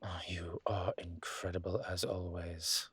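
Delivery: soft admiring whisper. Acted. Professor praising her lover, a soft admiring whisper. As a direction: breathe the compliment close. Intimate compliment.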